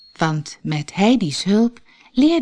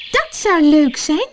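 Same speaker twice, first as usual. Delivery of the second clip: put-on higher, louder happy child; eager girl; 10 semitones higher, 5 dB louder than usual. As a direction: Heidi chiming in gladly. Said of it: "zei Heidi".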